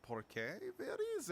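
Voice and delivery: playful put-on accent, foreign-sounding vowels and consonants; halting English in a hammed-up foreign accent. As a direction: slip into a mock foreign accent. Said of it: "I don't know why I have accent now".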